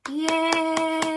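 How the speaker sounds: high, loud, drawn-out cheer; long celebratory whoop on a high held note. Real, not acted. Cheer at end of song.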